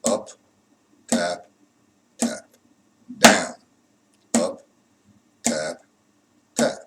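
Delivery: isolated words on a slow, even beat; name each stroke as it lands; calling out strokes. Shown as a demonstration. Calls each drum stroke in time.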